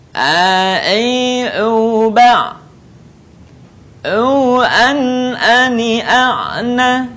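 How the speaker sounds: A, E, O chanted long from the middle throat; mid-throat ain. Shown as a demonstration. Teacher models an example, chanted throaty A, E, O. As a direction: hold each ain syllable on a sustained note. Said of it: "keluar dari tengah tenggorokan".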